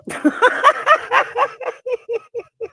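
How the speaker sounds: a real burst of high laughter. Real, not acted. Vlogger laughing at Martell's door call.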